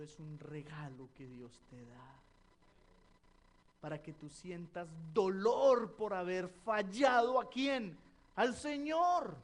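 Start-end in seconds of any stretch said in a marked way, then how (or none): 3.8-9.4 loud rising preaching, slowing on "Al Señor"; preaching climax. Acted. Pastor telling the church repentance is pain for failing the Lord, loud preaching climax. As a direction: drive it home loud to the Lord.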